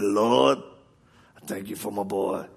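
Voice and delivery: a hint of a father's earnest prayer; imitating praying dad. Dad praying for his injured son, lightly imitated. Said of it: "Dad, pray for me".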